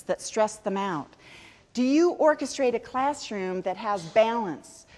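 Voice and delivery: ordinary talk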